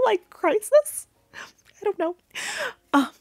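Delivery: high squeaky mock despair; mock dismay. Real, not acted. To the viewers, mock horror at being middle aged, pitched high.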